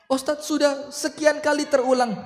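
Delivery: high, pleading complaint to the preacher; a wife pleading with her ustadz in a high voice; complaining wife. Acted. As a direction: whine it up high like a fed-up wife.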